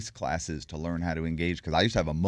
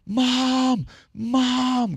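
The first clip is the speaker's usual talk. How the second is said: much higher, louder urgent call; 7 dB louder than usual. Retold or imitated. Waking his mother in the chair, calling loud and high. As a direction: call out to wake your mother in excitement.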